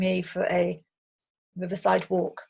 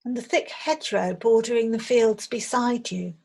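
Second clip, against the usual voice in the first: guided meditation; 3 dB louder than usual. Acted. Meditation teacher leading listeners on an imagined riverside walk, calm guided visualization. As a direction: guide listeners gently, phrase by phrase.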